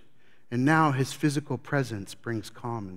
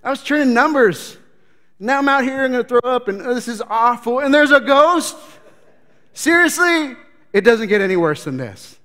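high, loud complaining tax collector; loud, high hammed-up whine of misery; whiny seasick tax collector; 8 semitones higher, 10 dB louder than usual. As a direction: moan it like a seasick pencil pusher. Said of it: "tax collectors in there going".

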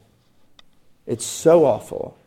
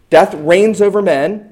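ordinary talk

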